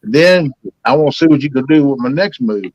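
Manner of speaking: ordinary talk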